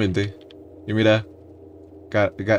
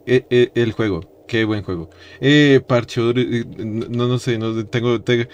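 normal talking voice

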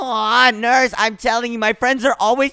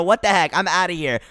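ordinary delivery

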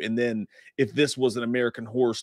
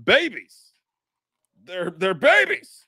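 high, loud impression, slowing down; drawn-out, high impersonation voice; Shredder impersonation; 7 semitones higher, 5 dB louder, much slower than usual. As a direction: cry it out in a high put-on voice. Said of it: "Child's just like".

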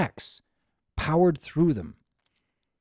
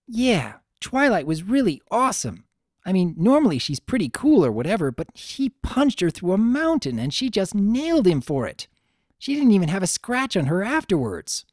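brash tomboy pony; 6 semitones higher than usual. Acted. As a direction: brag about your friend's fight. Rainbow Dash brags about Twilight.

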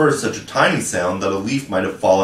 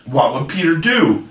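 cried together; 5 semitones higher than usual. Acted. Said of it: "they cried simultaneously".

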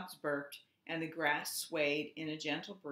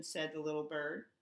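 plain reading voice

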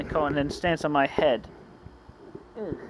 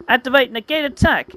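high, dramatic card command; raised, urgent battle cry; attack call-out; 8 semitones higher, 4 dB louder than usual. Acted. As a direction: call the move like an anime duelist. Calling out a card move.

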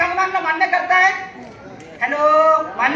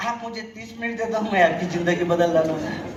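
low, softer pitch-man line imitating the recruiter; lower, dropping into the recruiter's pitch; salesman's catchphrase; 5 semitones lower, 6 dB quieter than usual. Recruiter at the door on Sunday pitching, low and soft. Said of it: "आणि direct म्हणतो".

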